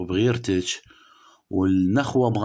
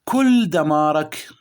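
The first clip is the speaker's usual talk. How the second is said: slow, loud, drawn-out stress on كل دمارك; emphatic stress; 5 semitones higher, 5 dB louder, much slower than usual. Real, not acted. Astrologer warns Sagittarius of their ruin, slow loud stress. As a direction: lean hard and slow on كل دمارك.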